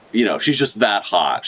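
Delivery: normal talking voice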